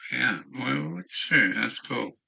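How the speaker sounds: ordinary talk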